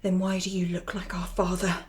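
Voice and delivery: low unvoiced frightened whisper; hushed, fearful whisper. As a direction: breathe it out in dread. Frightened realisation.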